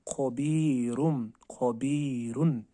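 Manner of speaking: खबीरुन slowly with a thick "kho"; heavy kho. Shown as a demonstration. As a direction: recite खबीरुन slow and heavy. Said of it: "हमने पढ़ना है खो ज़बर खो".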